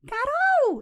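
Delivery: shrill put-on female voice calling out; disguised woman's voice. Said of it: "Se eu falar com a minha voz, vai vazar". (irmão fingindo voz de mulher, bem aguda e alta)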